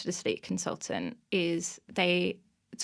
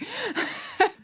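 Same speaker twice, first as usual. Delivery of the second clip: high laugh. Real, not acted. Laughing at her funny story.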